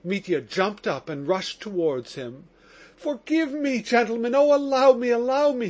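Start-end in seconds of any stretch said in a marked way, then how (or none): 3.0-5.7 high, loud pleading cry. A narrator's reading. Prisoner begging the police captain, high loud cry. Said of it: "he cried".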